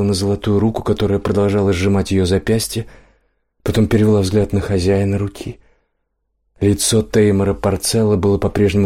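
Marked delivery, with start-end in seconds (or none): none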